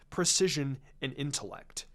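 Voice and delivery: ordinary delivery, nothing marked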